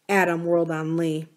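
usual speaking voice

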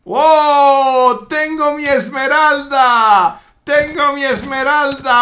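slow, drawn-out triumphant cry; gloating villain. Acted. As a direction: crow over the prize, stretching every word. Villain cat gloats over emerald. (villano triunfante, alargando las palabras)

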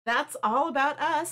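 normal talking voice

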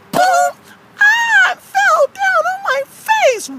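high, drawn-out wail; cartoon mock anguish; hammed-up tumble. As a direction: draw out the fall in a slow high cry. [name] acts out the fall.

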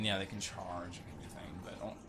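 usual speaking voice

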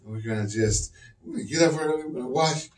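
soft, polite morning murmur; quiet, prim and mild-mannered impression.

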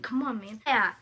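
ordinary talk

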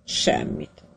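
a breathy one-word brush-off; curt whispered refusal. A narrator's reading. Prisoner stonewalling the captain in a whisper. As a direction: whisper it back, dry and unmoved.

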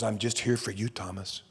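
soft, dropping low to almost nothing on Thomas; gently reassure, fading to a hush; tender Jesus. Acted. Jesus speaking to doubting Thomas, soft and tender.

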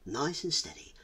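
ordinary delivery

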